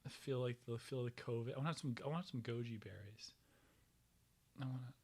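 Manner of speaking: soft unvoiced whisper to herself; whispered wish for snacks. Real, not acted. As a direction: mutter it under your breath while reaching. Quiet muttering while reaching for food.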